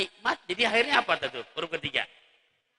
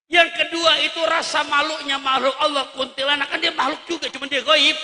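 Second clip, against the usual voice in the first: loud, fast, rousing sermon; fiery preaching; 8 semitones higher, 11 dB louder than usual. Acted. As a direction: press the congregation hard. Preaching at full force.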